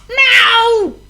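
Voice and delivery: very high, loud cat yowl; screeched meow. A narrator's reading. Ginger the cat hit by falling crockery, screeched yowl. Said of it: "screeched Ginger".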